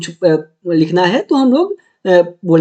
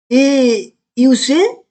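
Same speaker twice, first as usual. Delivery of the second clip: ए यू से drawn out letter by letter; slow spelling; 3 semitones higher, much slower than usual. Shown as a demonstration. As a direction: spell each letter out slowly for the class. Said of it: "क्या मालूम है". (ए यू से धीरे-धीरे, एक-एक अक्षर)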